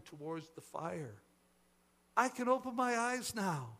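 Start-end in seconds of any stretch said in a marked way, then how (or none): 2.2-3.8 high, raised joyful proclamation; elated higher voice; proclaiming. A narrator's reading. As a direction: proclaim the discovery to everyone. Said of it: "she proclaimed".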